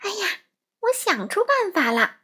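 high, swooping exclamation of a bright idea; excited storybook mum, pitch leaping up; sudden excited cry. A narrator's reading. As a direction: cry out as if an idea just struck. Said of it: "突然妈妈大叫".

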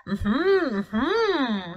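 humming through a mouthful; mouth full. Acted. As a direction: mumble it with cheeks stuffed full. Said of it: "speaking with his mouth full".

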